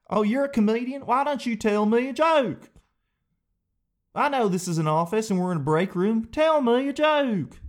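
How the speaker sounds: high-pitched Southern heckler demanding a joke; Southern accent. Acted. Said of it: "why they're all as Southern".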